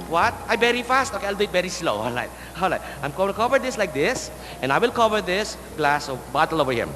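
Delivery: ordinary delivery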